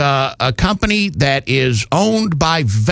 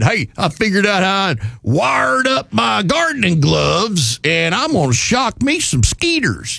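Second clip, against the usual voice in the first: put-on Southern accent; 6 semitones higher than usual. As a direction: shout it like a Southern goofball. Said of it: "we always do Southern accents".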